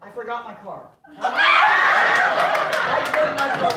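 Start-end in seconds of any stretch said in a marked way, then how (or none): 1.2-3.7 laughing shout. Real, not acted. Shouting in comic disbelief.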